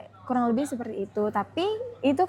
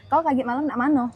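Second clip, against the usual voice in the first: casual Jambi Malay accent, demonstrated on request. Shown as a demonstration. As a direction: say it like a local from Muara Jambi. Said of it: "Gitu kalau di Jambi gimana?". (logat Jambi asli)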